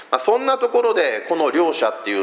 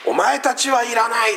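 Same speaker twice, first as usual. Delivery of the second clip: a touch higher, quoting a put-down from above; mild mock contempt, lightly voiced; dismissive put-down; 6 semitones higher than usual. 強い者が弱い者を突き放すように、軽い見下し口調. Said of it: "そこに上下関係がある".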